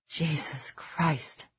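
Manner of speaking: slow, awed whisper of shock; hushed, slow gasp of disbelief; breathed whisper. A narrator's reading. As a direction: breathe it out in horror. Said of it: "Sykes breathed".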